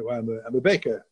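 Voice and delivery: normal talking voice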